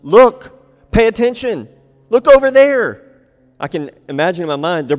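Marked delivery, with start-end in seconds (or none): 0.0-3.0 loud, high insistent pointing call; strong urgent call. Acted. Disciples urging John to look, loud and insistent. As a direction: demand they look right now. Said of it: "it's really strong".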